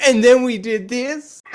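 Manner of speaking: high, loud, eager chatter; excited kid voice. Retold or imitated. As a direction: gush like a six-year-old.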